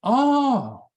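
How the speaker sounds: high, loud mock gasp of realisation; sudden realisation. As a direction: act out a student suddenly getting it. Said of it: "And the students go".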